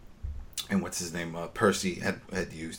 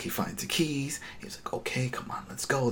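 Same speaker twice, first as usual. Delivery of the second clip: hushed, unvoiced retelling; quiet whisper while creeping around; 4 semitones higher, 9 dB quieter than usual. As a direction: whisper as if sneaking. Retelling a sneaking scene.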